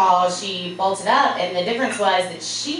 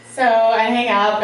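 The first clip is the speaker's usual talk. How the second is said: ordinary delivery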